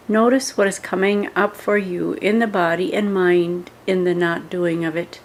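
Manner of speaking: ordinary delivery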